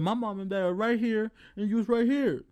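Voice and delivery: high-pitched, worked-up comeback; indignant retort. Retold or imitated. Worker refusing the manager parent-level respect, indignant.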